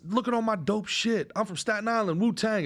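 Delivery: high-pitched show-off on a house tour; mock celebrity bragging. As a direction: imitate a rapper boasting to the camera. Acts out boastful MTV Cribs celebrity.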